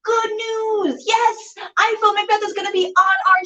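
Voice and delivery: high, bright announcement; excited. Real, not acted. Host telling viewers the show is coming, thrilled. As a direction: burst out with the good news.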